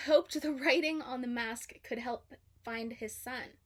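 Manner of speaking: ordinary delivery, nothing marked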